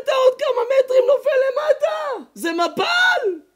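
high, loud shouted warning; alarmed yell. Retold or imitated. A bystander shouting to the man near the waterfall, alarmed yell.

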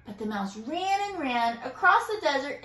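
plain reading voice

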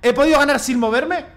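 agudo, incrédulo, subiendo hacia la risa; pregúntalo maravillado, con la voz más alta; asombro eufórico. Real, not acted. Asombro creciente por ganar quieto. (incredulidad feliz)

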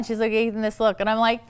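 ordinary talk, nothing marked

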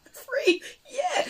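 high squeaky laugh; laughing squeak. Real, not acted. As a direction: giggle the word out high.

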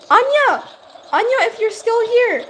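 high, loud call out. Real, not acted. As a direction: shout a name across the room. Calling out to viewer Anya.

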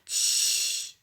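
soft unvoiced shush; a quiet shh. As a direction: hush everyone quietly. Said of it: "szepnął Azor".

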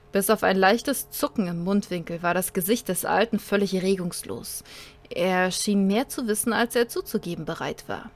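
dramatic narrator reading a sci-fi tale; story narration. A narrator's reading. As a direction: read it like an audiobook.

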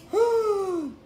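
high, drawn-in gasp; delighted gasp. Real, not acted. Snacker amazed by new crackers, awestruck gasp. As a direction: gasp over a wonderful snack.

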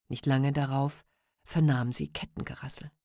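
ordinary delivery, nothing marked

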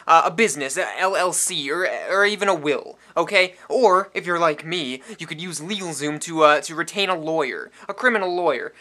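loud, pushy ad read from a shifty guy with something to hide; hard-sell it like a nervous small-time crook; a sketchy, shouting spokesman who keeps oversharing; shady loud pitchman. Acted.

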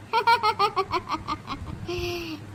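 soft drawn-out wicked laugh; gloating, wicked chuckle; villain laugh. Acted. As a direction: cackle quietly over your scheme.

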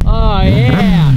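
loud, high hype shout; hyped. Real, not acted. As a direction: cheer it out loud. Hyping friend's new motorcycle.

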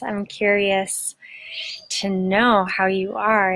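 normal talking voice

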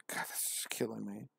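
unvoiced whisper; whispered aside. Real, not acted. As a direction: breathe it to yourself.

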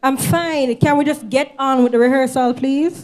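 loud, impatient insistence; exasperated. Acted. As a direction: push back loudly, out of patience. Snapping at worried choir mate.

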